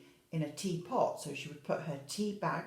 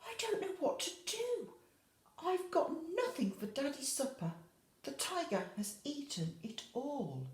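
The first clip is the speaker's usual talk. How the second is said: much higher, fretful mum voice; high, dismayed and helpless; worried mummy; 8 semitones higher than usual. A narrator's reading. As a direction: mum at a loss in the kitchen. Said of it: "Sophie's mummy said".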